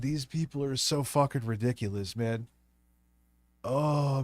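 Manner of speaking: ordinary talk, nothing marked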